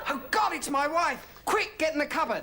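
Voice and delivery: high, hammed-up sitcom panic; urgently hiding someone from the wife; mock panic. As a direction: farce husband in a high flap. Husband hiding man from wife.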